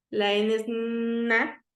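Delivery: "na" stretched out slowly; slow letter-sound demo of "na"; teacher sounding out "na" slowly. Shown as a demonstration. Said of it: "Ustedes saben que la P es".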